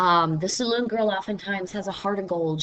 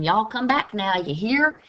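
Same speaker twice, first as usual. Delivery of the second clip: Southern accent; 3 semitones higher, 3 dB louder than usual. Shown as a demonstration. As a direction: lightly play a friendly Southern farewell. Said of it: "the very famous Southern".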